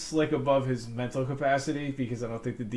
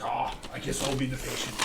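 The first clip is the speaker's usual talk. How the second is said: a badly faked French accent, resigned; 4 dB quieter than usual. Frenchie giving in to play the patient, bad French accent. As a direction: shrug it off in a mangled French accent. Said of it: "That was a bad accent".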